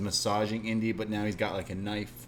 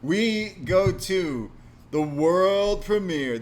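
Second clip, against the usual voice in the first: higher, louder, very slow ring-announcer call; announcer intro; 8 semitones higher, 6 dB louder, much slower than usual. Acted. Podcast host to listeners, slow hammed-up announcer call. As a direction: boom it out like a grand premiere.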